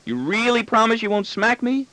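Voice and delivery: put-on very high child's question, leaning on REALLY; insistent boy's plea. Son pressing his father to really promise, high insistent plea. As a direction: press for reassurance in a small boy's voice.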